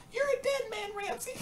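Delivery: tiny, very high squeak; hammed-up squeaky threat from a big brute; high-voiced tough guy. Wrestler villain threatens after dusting himself off, squeaky high voice. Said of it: "it was the same way".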